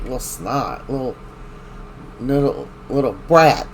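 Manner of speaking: usual speaking voice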